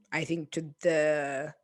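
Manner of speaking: ordinary delivery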